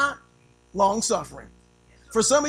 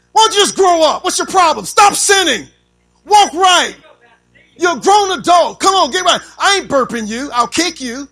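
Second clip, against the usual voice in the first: harsh scolding; 6 semitones higher, 13 dB louder than usual. Shown as a demonstration. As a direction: bark at a new believer to grow up. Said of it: "That's how we are".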